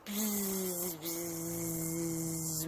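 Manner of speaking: soft, low sustained buzz; buzzing warm-up. Shown as a demonstration. Teacher demonstrating warm-up, soft low buzzing. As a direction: buzz softly and steadily. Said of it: "we just wanna go like this".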